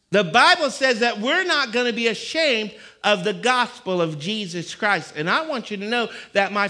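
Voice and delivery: loud, high preaching, slowing at the end; fiery preaching. Real, not acted. Preacher to his congregation, full-force preaching. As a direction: preach it out to the back row.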